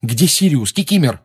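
loud, insistent demand. A narrator's reading. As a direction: press the elf for an answer. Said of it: "требовательно спросил Гарри".

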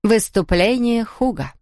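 ordinary delivery, nothing marked

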